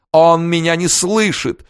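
high, slow, theatrical wail; dramatic wail. A narrator's reading. Princess dramatically wails to the room, high and slow. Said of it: "драматически возопила Верейская".

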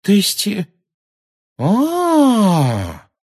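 halting, then a long high "а"; stumble, then draw out a high, loud oh; dawning realisation. Acted. Pupil suddenly understanding the centaur, high drawn-out realisation. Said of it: "её лицо просветлело".

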